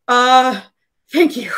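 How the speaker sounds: loud, high, frantic panic; freed man panicking as he apologizes to the party; panicked NPC. Acted. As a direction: blurt it out in a rush of panic and guilt.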